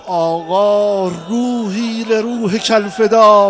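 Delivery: loud, slow, drawn-out lament; wailing devotion. Acted. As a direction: cry it out slowly to your lord. Mother answers her lord, wailing.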